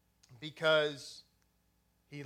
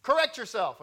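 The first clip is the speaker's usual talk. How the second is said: drill instructor; 5 semitones higher, 7 dB louder than usual. Retold or imitated. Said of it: "They were like".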